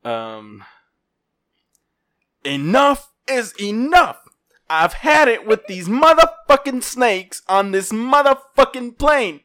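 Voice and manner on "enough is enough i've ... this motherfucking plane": slow, higher, punchy movie-line delivery; a loosely done Samuel L. Jackson; Samuel L. Jackson impression. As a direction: growl out the snakes line like the film. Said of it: "Samuel L. Jackson".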